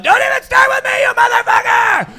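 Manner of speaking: screaming way up high and loud; furious shriek. Pretend. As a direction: shriek at the intruder bear. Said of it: "angry girl ... going".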